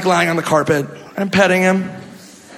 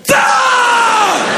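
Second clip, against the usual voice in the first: mock battle cry. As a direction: shriek like launching into a brawl. Imitating a fight yell.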